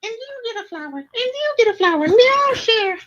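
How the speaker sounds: very high squeaky puppet handing out gifts; sing-song creepy Elmo sharing flowers; creepy puppet giving each child a flower; Satan Elmo voice. Acted. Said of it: "I sound like Satan Elmo".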